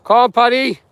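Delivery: high, loud call to the dog. Real, not acted. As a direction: shout for the dog across the field. Calling the dog back.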